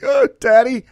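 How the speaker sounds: high, awed fanboy gush; star-struck fan meeting his idol; starstruck gasp. Retold or imitated. Fanboy meeting Gene in elevator.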